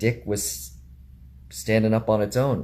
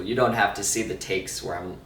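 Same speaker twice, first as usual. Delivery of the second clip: ordinary talk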